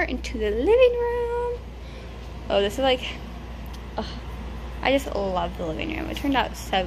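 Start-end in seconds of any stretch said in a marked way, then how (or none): none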